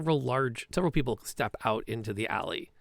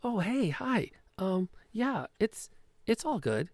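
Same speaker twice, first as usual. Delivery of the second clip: high, breezy, overly casual cover; nervous Petra; 8 semitones higher, slower than usual. Acted. Petra greets the warehouse thug, high and too casual. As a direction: play it cool while clearly lying. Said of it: "DM replays Petra's greeting".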